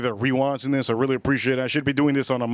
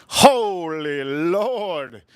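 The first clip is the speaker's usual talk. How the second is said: slow, drawn-out amazement; awed; much slower than usual. Real, not acted. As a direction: let the bottle take your breath away. Awed by bottle beauty.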